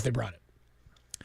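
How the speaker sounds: soft, slow, breathy-free hush; dramatic whisper. Real, not acted. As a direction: drop to a whisper for the payoff. Dramatic closing line.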